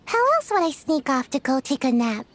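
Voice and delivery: ordinary talk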